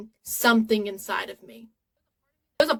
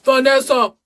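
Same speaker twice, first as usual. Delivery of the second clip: loud call across the house; yelling for Vanessa; 9 dB louder than usual. Acted. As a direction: shout the name to get her attention. Calling out a name.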